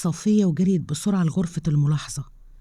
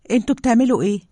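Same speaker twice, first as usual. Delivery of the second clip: higher, louder shocked demand; alarmed outcry; 6 semitones higher, 5 dB louder, faster than usual. Acted. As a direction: burst in on them, alarmed. She finds brother drugged. (صرخة مفزوعة)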